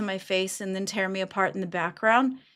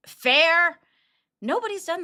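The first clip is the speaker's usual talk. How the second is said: louder and higher, voice strained; raised voice; 9 semitones higher, 4 dB louder than usual. Real, not acted. Hurt co-host defending how fair he has been, loud. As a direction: let the word burst out, hurt. Upset host venting about being pushed.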